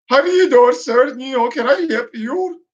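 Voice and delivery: mocking Indian accent, a touch garbled; mock underwater-sounding Indian accent. Mocking a clerk who speaks like he's underwater, Indian accent. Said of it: "how they speak, like they're underwater".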